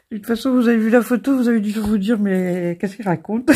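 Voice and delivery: giggling at her own mistake; laughing. Real, not acted. Fou rire en montrant la photo. (en riant, plus fort et plus aigu)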